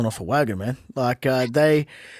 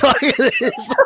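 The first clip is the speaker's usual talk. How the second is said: high, breathless laughter at his own story; helpless high laugh; 4 dB louder than usual. Real, not acted.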